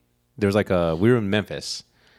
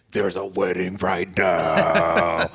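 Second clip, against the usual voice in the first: slow mock furious shout; retold angry yell; much slower than usual. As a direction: bellow it like a raging mom. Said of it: "tears their heads off and being like".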